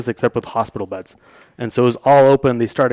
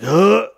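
high, sharp mock gasp; imitated gasp; 8 semitones higher, 3 dB louder than usual. Patient as the nurse stabs his leg, imitated gasp. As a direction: act out a sudden gasp at a needle stab. Imitates reaction to being stabbed.

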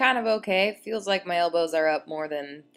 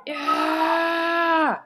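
high loud lion roar; roaring yeah; 9 semitones higher, 5 dB louder than usual. Real, not acted. Roaring at workout finish.

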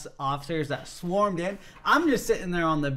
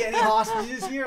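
very high mock officer call; comic high-pitched query through laughter; searching officer. Officer searching under the bed, mock high call.

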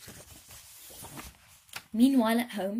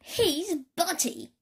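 shouted; 8 semitones higher, slower than usual. Acted. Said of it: "shouted all the other aliens".

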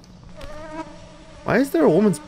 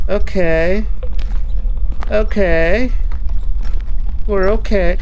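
loud, higher, breathless okays; shaky self-calming; 5 semitones higher, 6 dB louder, slower than usual. Real, not acted. Gamer calming himself after a scare, loud and still rattled. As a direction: talk yourself down from the fright.

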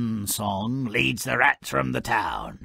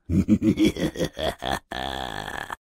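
low chuckle; 13 semitones lower than usual. Real, not acted. Laughs after joke on fear.

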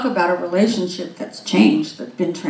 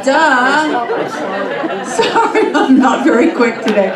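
high, loud, laughing through the words; laughing at herself; 5 semitones higher, 6 dB louder, slower than usual. Real, not acted. Preacher laughing at missing the Minnesota joke, real laughter. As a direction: giggle off the embarrassment.

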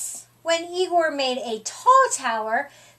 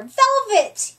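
a touch of a higher shout; mild yell; 8 semitones higher, 5 dB louder than usual. A narrator's reading. Scarlet calls out a kitten name in a mild yell. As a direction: call out the name eagerly. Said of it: "yelled Scarlet".

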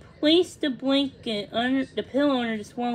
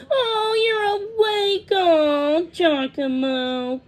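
high, slow, loud joyful cry; tearful relief; 8 semitones higher, 8 dB louder, much slower than usual. A narrator's reading. Thumbelina hugs her revived friend, crying with relief. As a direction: cry out in relief, letting the pitch sink. Overjoyed the swallow has woken.

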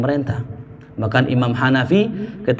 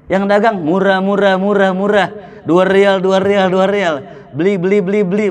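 Jeddah shop hawker; 4 semitones higher than usual. Retold or imitated. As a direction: hawk your wares like a street seller. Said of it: "Begitu ngomongnya".

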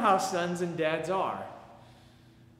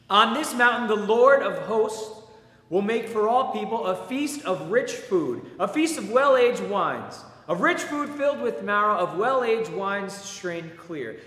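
louder, lifted proclamation of scripture; solemn scripture reading; 4 semitones higher, 5 dB louder than usual. Acted. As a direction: proclaim the prophet's words to the congregation. Reads Isaiah aloud in church.

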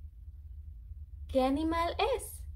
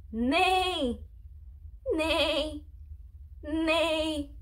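horse neigh imitation; 2 semitones higher, much slower than usual. Shown as a demonstration. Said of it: "What does the horse say?".

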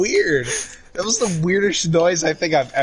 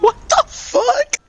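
shocked; slower than usual. Real, not acted. Shocked at in-game death.

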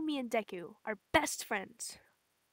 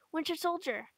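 ordinary delivery

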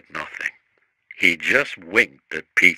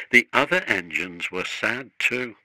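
plain reading voice

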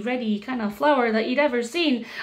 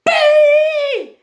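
very high, loud, long-held shout; king's yell; 12 dB louder than usual. Acted. King spotting a bee on his nose, alarmed yell. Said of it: "and the king yelled".

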